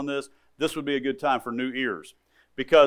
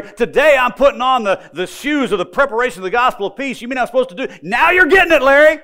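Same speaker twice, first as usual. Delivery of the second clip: much higher and louder, ringing out; triumphant climax; 9 semitones higher, 10 dB louder than usual. Acted. Professor cheers Larry on at the peak, jubilant. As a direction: shout it like a victory. Preacher's climax acting both voices.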